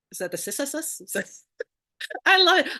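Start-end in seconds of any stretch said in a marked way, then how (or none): 2.3-2.7 excited gush. Real, not acted. Gushing after laughing at a mispronunciation.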